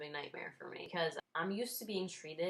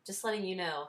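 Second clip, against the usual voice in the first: usual speaking voice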